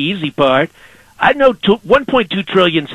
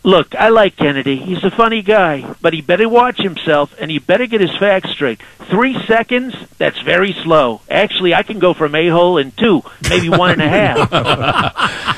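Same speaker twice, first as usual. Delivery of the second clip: Donald Trump impression. Trump calling in to radio hosts, swaggering and boastful. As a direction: sell every line like the president on a call-in.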